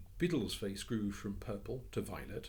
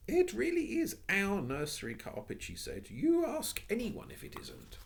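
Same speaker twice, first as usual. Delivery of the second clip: put-on much higher, stubborn child's voice; insistent little girl; 14 semitones higher, 6 dB louder than usual. Jane insisting to Missus Biddle, stubborn. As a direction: stand your ground as a small girl.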